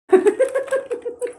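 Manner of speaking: loud, high burst of laughter. Real, not acted. Vlogger bursting out laughing to viewers, loud and high.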